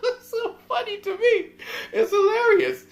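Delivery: high, loud laughter through the words; can't stop laughing at the memory; laughing hard. Real, not acted.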